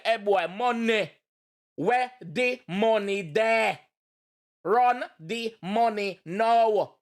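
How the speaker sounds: slow, drawn-out threat of a robber; mock robber. Imitating a robber with a gun to his head, slow and menacing. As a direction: drag out each demand like a stick-up. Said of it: "Put the gun on my head and say".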